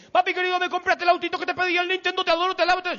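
gushing little son. Retold or imitated. Father voicing his son's gushing praise, high child voice. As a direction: fawn like a small boy praising daddy. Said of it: "cuando llego a casa me dice".

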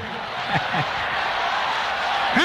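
soft unvoiced laugh of delight; breathy joyful laugh. Real, not acted. Preacher laughing with joy before crying out praise. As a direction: let a quiet laugh slip out.

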